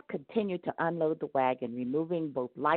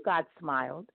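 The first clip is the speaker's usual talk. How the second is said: ordinary delivery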